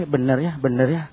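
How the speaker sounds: lightly acted doubt; doubting believer.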